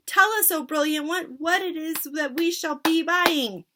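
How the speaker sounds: very high, loud screeching chorus; witch chorus. Acted. Witches begging their leader, high screech. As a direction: shriek it like eager witches.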